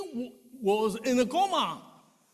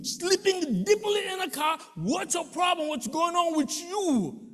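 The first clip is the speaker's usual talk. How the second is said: raised, higher scolding voice; exasperated driver shouting at a dozing rider; angry scolding; 9 semitones higher than usual. Retold or imitated. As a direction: scold a passenger who slept through the stop. Driver scolding sleeping passenger.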